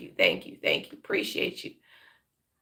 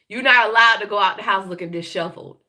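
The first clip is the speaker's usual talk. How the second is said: ordinary delivery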